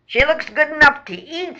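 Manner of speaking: high, loud rat squeal. Acted. Hungry rat eyeing a captured frog, squealing. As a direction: squeal it with greedy relish. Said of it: "squeals Swanson".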